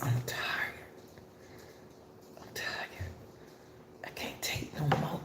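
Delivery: faint, drained whisper; exhausted whisper. Retold or imitated. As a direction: let it barely come out, spent.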